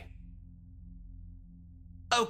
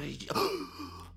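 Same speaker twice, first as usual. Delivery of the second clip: excited gasp; 3 dB quieter than usual. Acted. Sudden idea.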